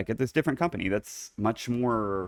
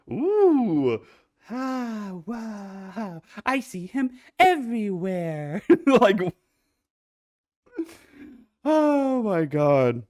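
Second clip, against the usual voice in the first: swooning; 9 semitones higher, much slower than usual. Real, not acted. Swooning over fictional man.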